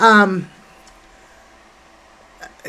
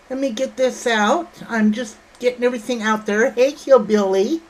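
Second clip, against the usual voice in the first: normal talking voice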